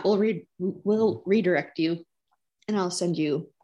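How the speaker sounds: normal talking voice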